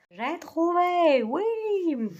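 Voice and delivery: high, drawn-out cry of triumph; excited find. Real, not acted. Finally finding the book behind the couch, delighted high cry. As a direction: sing out the win at finding it.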